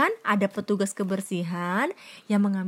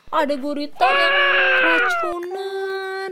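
dramatic; 8 semitones higher, 5 dB louder, slower than usual. A narrator's reading. Dramatic reading of poisoned octopus.

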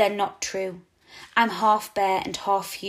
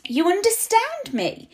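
surprised; 13 semitones higher than usual. A narrator's reading. Owl surprised at the girl.

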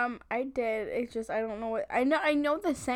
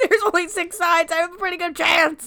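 laughing; 6 semitones higher, 9 dB louder than usual. Retold or imitated. Contestant quote, laughing.

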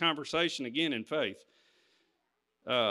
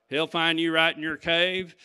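usual speaking voice

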